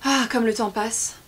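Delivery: loud, slow, drawn-out lament; aggrieved old king's sigh of complaint; lamenting exclamation. A narrator's reading. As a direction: exclaim as if time caused every woe. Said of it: "secoua la tête en s'exclamant".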